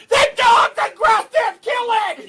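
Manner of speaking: high, loud mock-panicked yell; hammed-up high panicky shout. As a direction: shriek it like a frightened relative. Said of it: "they're like".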